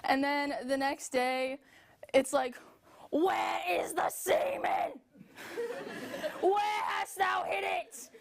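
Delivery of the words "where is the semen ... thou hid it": slow, high, shouted archaic demand; demonic demanding uterus. Acted. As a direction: bellow it like a possessed womb.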